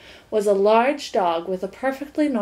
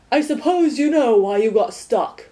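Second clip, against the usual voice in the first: stern watchdog; 2 semitones higher, 4 dB louder than usual. A narrator's reading. Watchdog lecturing Milo.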